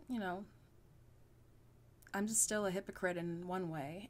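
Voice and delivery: ordinary talk, nothing marked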